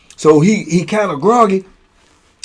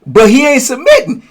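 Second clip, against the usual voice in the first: ordinary talk, nothing marked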